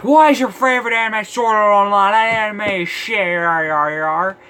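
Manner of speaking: loud, high mock rant trailing into nonsense; raging commenter. Reviewer mocking raging commenters about his favorite anime, mock rage. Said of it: "hear the raging comments like".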